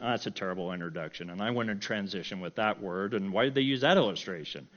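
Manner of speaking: low, quick critical aside; critical nitpicking. Retold or imitated. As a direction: pick it apart under your breath. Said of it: "to be critical".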